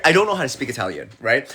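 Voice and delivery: usual speaking voice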